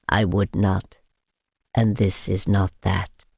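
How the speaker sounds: steady and courteous, low; calm vampire. A narrator's reading. Vampire asks her to cut him quickly, calmly. Constantine's voice.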